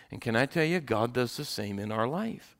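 ordinary delivery

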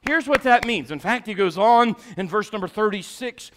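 loud, high, driving sermon voice; full-force preaching. Acted. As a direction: hammer each line at the congregation.

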